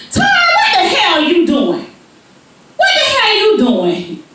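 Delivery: high loud mock yell; angry mother's shout; mama shouting. Mama catching Tara out of seat, shouting.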